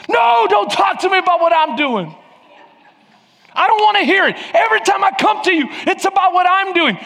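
high, loud angry protest; angry outburst at God. Retold or imitated. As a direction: refuse to hear about yourself. Acted argument with God.

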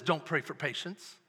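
Don't pray for patience dropped low and soft; mock quote. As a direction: toss it off low. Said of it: "The Bible never says".

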